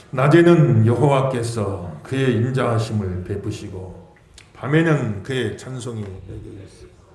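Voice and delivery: slow, measured recitation of the verse; scripture reading aloud. Acted. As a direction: read it out for the congregation to follow. Leads congregation reading.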